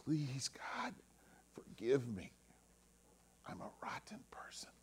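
whispered, humble plea; desperate whisper. Acted. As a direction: breathe the prayer out like a broken man. Humble sinner's prayer voiced in story.

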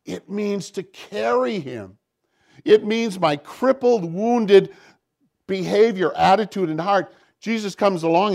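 loud, rising pulpit fervor; fervent preaching. Real, not acted. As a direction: drive it home to the congregation. Impassioned climax of sermon.

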